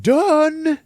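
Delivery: high, loud, stretched-out yell; one long shouted word; yelled announcement. Retold or imitated. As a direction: holler it through the house. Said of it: "he just yells out".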